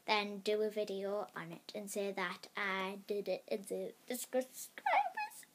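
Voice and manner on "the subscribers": squeaky high voice. Pretend.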